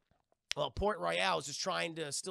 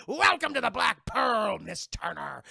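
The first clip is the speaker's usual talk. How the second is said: louder, slow booming welcome; gloating captain; 5 semitones higher, 9 dB louder, slower than usual. Retold or imitated. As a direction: boom it out as he gloats.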